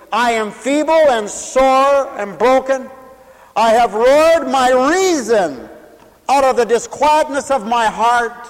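high, loud and slow, crying out the verse; full-force preaching. Acted. Preacher crying out the psalm of a broken heart, loud and slow. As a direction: wail the psalm out to the whole church.

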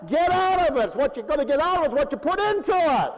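loud, high exhortation; fired-up pulpit shout; impassioned preaching. Real, not acted. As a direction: drive it home at full voice. Preacher drives the point home.

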